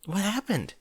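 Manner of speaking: gently worried pony; concerned Fluttershy voice. Acted. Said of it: "her confusion becoming concern".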